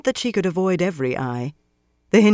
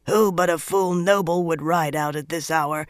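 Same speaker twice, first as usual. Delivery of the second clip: muttering groom. A narrator's reading. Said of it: "she had heard Kazin mutter".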